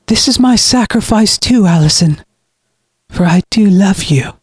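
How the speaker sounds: high-pitched, self-righteous farewell; cold brother in farewell. Acted. Brother leaves his sister to die, coldly devoted. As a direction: justify abandoning the one you love.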